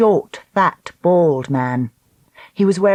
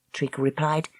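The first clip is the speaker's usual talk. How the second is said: plain reading voice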